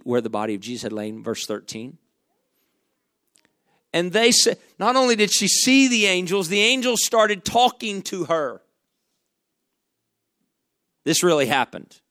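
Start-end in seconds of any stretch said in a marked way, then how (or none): 3.9-8.6 rising, louder preacher's cadence; full-force preaching. Acted. Preacher building to the crowd, rising and louder. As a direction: preach it up to the congregation.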